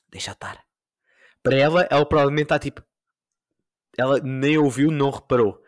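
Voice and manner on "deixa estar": whispered deixa estar. Retold or imitated. S0 telling his mother never mind: whispered.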